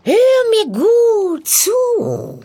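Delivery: high, slow sly hag voice; a crone spelling out her scheme; old witch. A narrator's reading. Witch explaining the treasure to the soldier, sly hag voice.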